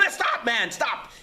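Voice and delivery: high, loud cries to stop; the woken monk shouting to stop them, high; frantic shout. Acted. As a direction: yell at him to stop. Monk calling out again.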